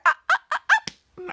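high startled ah-ah-ah; shocked gasps. Retold or imitated. Phoebe gasping at what she'd done, high. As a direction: gasp out in high little cries. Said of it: "what she had done was like".

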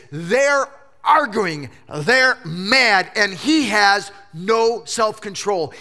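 ordinary talk

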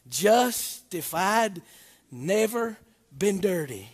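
hushed preacher lingering on grace; tender preaching. Acted. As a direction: savor each word gently. Slow soft wordplay.

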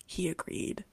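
hammed-up seductive read-aloud, lightly done; sexy podcast voice. Host reading the book summary to listeners, mock-sultry low voice. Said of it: "I'm gonna read it in my sexy podcast voice".

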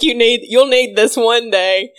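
put-on light German accent, fatherly warning; a hint of an old German man. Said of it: "in his little German accent".

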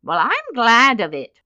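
loud, lightly voiced old whiner; whining old man. A narrator's reading. Aged newborn welcoming his removal, whining. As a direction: whine it out peevishly. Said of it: "whined the old man".

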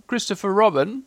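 high, questioning call; lightly voiced call from above. A narrator's reading. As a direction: call a name down for attention. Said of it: "he called down to you again".

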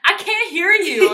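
put-on high, loud old lady shouting; mock old lady yell. S1 imitating an old lady yelling, loud and high. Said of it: "one old lady was like".

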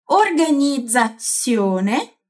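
"Organizzazione" with voiced dz then voiceless ts, very slow; slow syllable by syllable. Shown as a demonstration. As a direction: stretch out "Organizzazione" slowly. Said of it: "Repete comigo".

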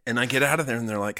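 ordinary delivery

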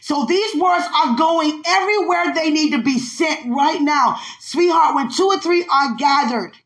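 rising preaching. Real, not acted. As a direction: lift it into full preaching.

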